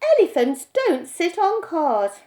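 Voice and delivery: firm correction. A narrator's reading. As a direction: set him straight. Mother insists.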